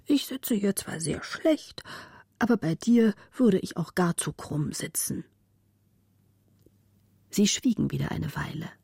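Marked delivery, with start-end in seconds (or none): 0.1-5.2 a lightly voiced old servant; old man voice. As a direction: speak as a tired old man. Old Müffert answers.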